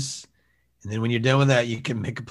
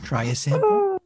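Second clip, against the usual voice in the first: high sample hawker. Acted.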